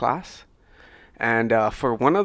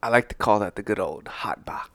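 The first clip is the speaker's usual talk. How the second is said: holding in smoke; 3 semitones lower, 3 dB quieter than usual. Real, not acted. Speaks while passing the smoke.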